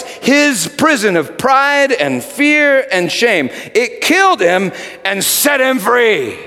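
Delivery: high, loud building proclamation; preaching climax. Acted. Preacher to congregation about Peter, building loud proclamation. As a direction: drive each line harder.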